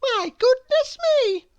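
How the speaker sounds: very high, loud wail; farmer's wife wailing. Acted. Farmer's wife caught in the heap, wailing. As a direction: wail it out in a high comic woman's voice. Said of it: "wailed the farmer's wife".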